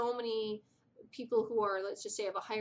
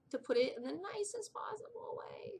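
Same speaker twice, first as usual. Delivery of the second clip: higher, whispered tactful aside; 8 semitones higher than usual. Real, not acted. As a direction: drop it to a confiding whisper. Tactful aside about her love life.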